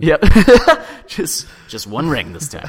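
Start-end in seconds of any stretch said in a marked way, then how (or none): 0.3-1.0 loud laugh. Real, not acted. Laugh at joke.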